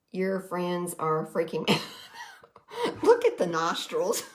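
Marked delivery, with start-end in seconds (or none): 1.7-4.4 laughing. Real, not acted. Laughing at mounted animal heads.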